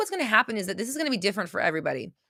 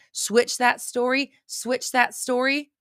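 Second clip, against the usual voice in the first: slow emphatic repeat; 5 semitones higher, much slower than usual. Shown as a demonstration.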